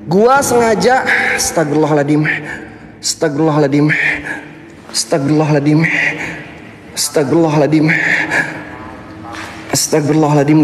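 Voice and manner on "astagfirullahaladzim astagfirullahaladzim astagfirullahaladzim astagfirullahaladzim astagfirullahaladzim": low, steady murmured dhikr; repeated prayer. Real, not acted. Streamer repeating a prayer for protection, low. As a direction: chant it low and even.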